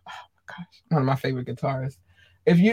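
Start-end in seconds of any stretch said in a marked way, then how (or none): 0.1-0.8 breathless whispered awe; gushing whisper. Real, not acted. Fan whispering over her favorite guitarist.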